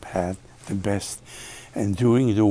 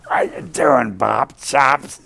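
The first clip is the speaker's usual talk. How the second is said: higher and louder, a hammed-up [name] greeting; [name] impression; 4 semitones higher, 8 dB louder, slower than usual. Louis hailing the guitarist at the Blue Note, hammed-up Louis voice. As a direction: belt out the greeting like Satchmo. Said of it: "He called me Bob Chops".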